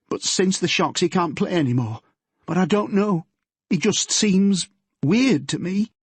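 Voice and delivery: put-on nervous girl confessing to her father; teenage daughter Yvonne.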